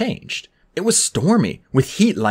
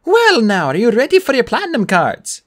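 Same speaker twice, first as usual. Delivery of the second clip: high, loud sales greeting; eager bellhop; 8 semitones higher, 4 dB louder than usual. A narrator's reading. Bellhop hurries up to offer guests cards, eagerly. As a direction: pitch it up like an eager host.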